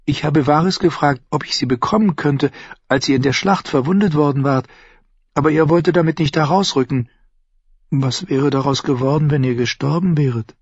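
junge Frau, höher gesprochen, neugierig; Shay. Acted. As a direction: fragt ihn leise drängend aus. Shay fragt Tyrion. (Shay, junge Frau, höhere Stimme)

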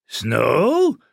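high, rising bird cry; screeching raven. A narrator's reading. Agitated raven crying its master's name, rising screech. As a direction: screech it upward like a talking raven. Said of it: "schrie der Vogel immer wieder".

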